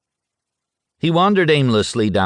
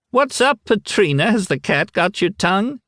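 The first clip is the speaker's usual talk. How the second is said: much higher, cheeky taunt; teasing kid; 14 semitones higher than usual. A narrator's reading. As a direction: needle him like a cheeky boy.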